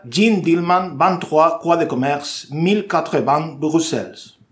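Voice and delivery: slow, exaggerated bad French; broken French. Shown as a demonstration. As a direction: read the French title clumsily, slowly. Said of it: "mi francés macarrónico".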